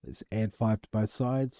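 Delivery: ordinary talk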